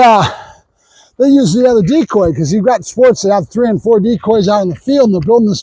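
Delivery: ordinary delivery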